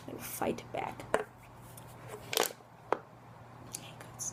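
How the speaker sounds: hushed, barely voiced warning; very soft, spooked whisper; mock-fearful whisper. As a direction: whisper it as if the goats might hear. Mock fear of the goats.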